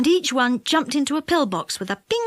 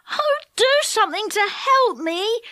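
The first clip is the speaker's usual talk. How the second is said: put-on high, pleading boy; begging; 9 semitones higher than usual. A narrator's reading. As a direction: plead for help. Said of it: "begged Dick".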